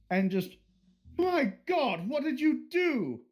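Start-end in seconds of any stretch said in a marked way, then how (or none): 1.2-3.2 shocked cry. Acted. Chef shocked at the room.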